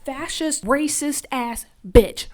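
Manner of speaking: slow, loud, hammered out insult; furious insult. Retold or imitated. Lizzie yelling at Ruby, hammered-out fury. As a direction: spit every word of it out. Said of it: "Lizzie ... she's crying".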